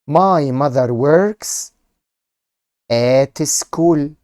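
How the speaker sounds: slow, loud, word by word; slow dictation. Shown as a demonstration. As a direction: spell it out slowly for class. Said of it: "S C".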